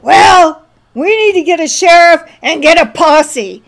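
rally the town like a proud mayor; mayor rallying the townsfolk to get a posse, loud bluster; mayor's decree. Retold or imitated. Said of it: "the mayor says".

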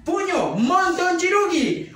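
usual speaking voice

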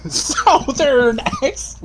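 "Southern" stretched high and slow, first vowel off; mocking mispronunciation. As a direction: mimic a botched "Southern", drawn out and high. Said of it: "that's not how you say southern".

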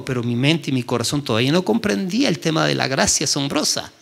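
ordinary delivery

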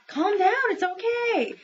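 put-on calm them as a little boy; boy comforting.

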